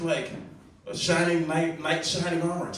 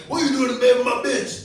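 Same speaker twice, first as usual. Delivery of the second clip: high, louder mock confrontation; comic fake outrage at a rival; mock outraged yell; 10 semitones higher, 5 dB louder than usual. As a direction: yell it like a jealous boyfriend, half in jest. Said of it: "you're not like".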